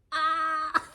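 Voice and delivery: loud high squeal breaking into laughter; excited squeal. Real, not acted. Vlogger shocked she got it, loud squeal. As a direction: shriek with delight, then laugh.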